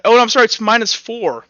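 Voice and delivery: ordinary talk, nothing marked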